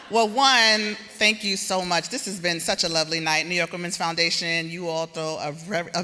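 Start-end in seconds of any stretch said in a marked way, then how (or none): 0.0-2.1 projecting loudly. Real, not acted. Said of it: "I feel like I have to talk like this 'cause we're in the plaza".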